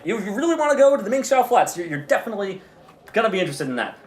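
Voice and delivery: high, insistent sales pitch; pushy hawker. Acted. Annoyed hawker pressing the portal on her, high pitched. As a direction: push the sale hard.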